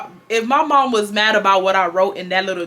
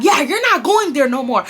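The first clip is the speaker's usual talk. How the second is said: higher-pitched mock taunt; mother's retold taunting; 6 semitones higher than usual. Mom banning daughter from the club, taunting. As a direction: imitate a parent rubbing it in. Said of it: "taunting me at this point".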